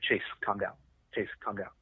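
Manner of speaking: softly imitated plea to settle; subdued, even retold instruction; retold calming. Retold calming of panicking driver.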